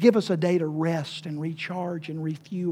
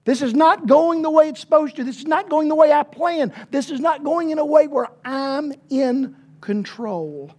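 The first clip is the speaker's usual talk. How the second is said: hurried, high, loud indignant rant; angry ruler; 8 semitones higher, 4 dB louder than usual. Acted. Preacher voicing the indignant synagogue ruler, fast and loud. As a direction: sputter it out like an offended official.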